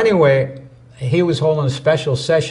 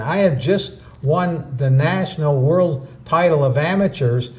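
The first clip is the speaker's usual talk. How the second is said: ordinary delivery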